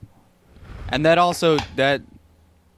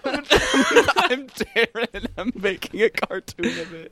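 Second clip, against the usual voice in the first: laughing imitation; 9 semitones higher, 3 dB quieter, much slower than usual. Mimicking the note, laughing.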